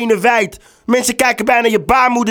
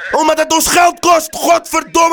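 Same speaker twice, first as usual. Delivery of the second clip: furious shout; 8 semitones higher than usual. Acted. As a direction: explode at her. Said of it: "je kan wel gaan lopen schreeuwen".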